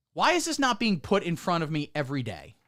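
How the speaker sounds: ordinary delivery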